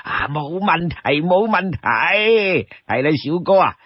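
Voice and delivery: put-on slow, stretched, delighted old man's voice; chuckling old-timer, swooping and unhurried; obliging old man. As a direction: play a happy old host, dragging the endings.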